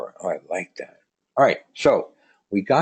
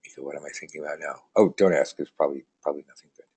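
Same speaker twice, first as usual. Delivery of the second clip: ordinary delivery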